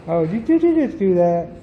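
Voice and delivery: ordinary delivery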